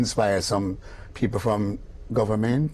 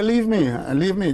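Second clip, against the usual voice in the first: high, firm brush-off; firm refusal; 7 semitones higher than usual. Retold or imitated. Clive telling the guide to leave him, high and firm. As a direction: shoo him away.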